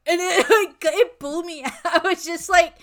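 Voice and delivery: laughing loudly while talking. Real, not acted. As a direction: laugh through the words. Laughing at the memory.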